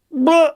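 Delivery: very high, loud buh; silly noise. Acted. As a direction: pop out a squeaky buh.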